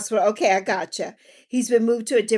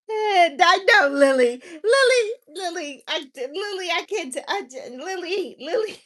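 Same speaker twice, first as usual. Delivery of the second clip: laughing to tears; 6 semitones higher than usual. Real, not acted. Laughing hard at Lily's chat.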